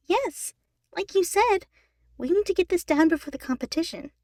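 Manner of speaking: high, loud eager insistence; high girl voice. A narrator's reading. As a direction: insist brightly. Brittany insisting to Ian.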